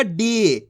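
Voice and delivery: डी with a long, held ee; teacher's drawn-out डी, falling at the end; stretched long ee. Shown as a demonstration. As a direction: draw out the vowel in डी. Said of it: "खींच के पढ़ा जाता है".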